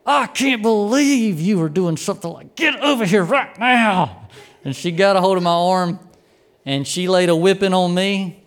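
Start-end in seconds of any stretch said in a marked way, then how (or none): 0.0-4.3 imitated furious mother, high and strained through clenched teeth; talking through teeth. Mother scolding her grape-throwing child, imitated talking through teeth. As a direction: force it out through gritted teeth, higher-pitched. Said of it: "She was talking through her teeth with everything she had".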